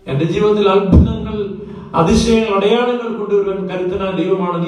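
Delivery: high, loud pulpit proclamation; fervent preacher's cry; full-force preaching. Acted. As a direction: thunder the promise to the congregation.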